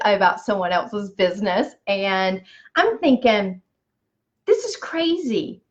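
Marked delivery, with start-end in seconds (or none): 4.5-5.6 a high, lightly voiced rush of excitement; excited thought. At the conference, buzzing over the friend's business, high excited. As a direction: think it with a high, giddy lift.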